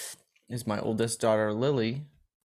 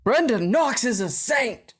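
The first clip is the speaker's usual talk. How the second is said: much higher, louder exclamation; excited praise; 11 semitones higher, 6 dB louder, slower than usual. Real, not acted. Friend loudly praising the mother with excited gusto. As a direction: proclaim it with gusto.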